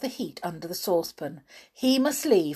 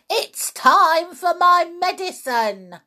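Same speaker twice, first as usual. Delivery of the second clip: put-on old woman calling from afar; loud calling grandma, high; from another room; 9 semitones higher, 5 dB louder than usual. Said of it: "came the awful voice from the next room".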